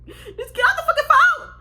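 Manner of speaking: high, loud laugh-cry; half laughing, half sobbing. Real, not acted. She tells mom to hang up, laugh-crying, high and loud. Frantic while mom keeps talking.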